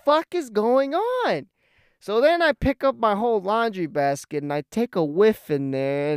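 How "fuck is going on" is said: higher and slow, baffled and fed up; bewildered outburst. Retold or imitated. Finding his socks stink too, he asks in baffled disgust. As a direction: demand to know what's happening. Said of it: "I'm like".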